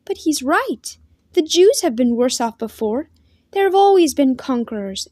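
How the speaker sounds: lightly voiced sister, urgent and reassuring; pleading girl.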